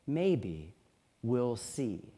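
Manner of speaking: low and soft, a gentle knowing calm; knowing twinkle. A narrator's reading. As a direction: let a small private amusement show. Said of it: "with a little twinkle in her eye".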